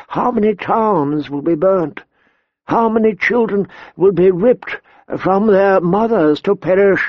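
put-on high, slow, quavering old man; old maester. A narrator's reading. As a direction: plead like a frail old scholar.

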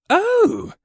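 very high, loud exclamation; intrigued gasp. A narrator's reading. General seeing the pans' folding handles, high intrigued gasp. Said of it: "the general gasped with intrigue".

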